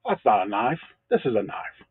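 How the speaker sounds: lightly voiced Australian movie hero; retold film line in a mock Aussie accent; understated, joking Aussie impression; Crocodile Dundee. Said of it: "Crocodile Dundee".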